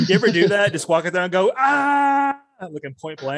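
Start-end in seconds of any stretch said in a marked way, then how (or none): 1.6-2.4 long, high held "ah"; mock battle cry. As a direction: hold one high, steady mock yell. Mimes firing off outside.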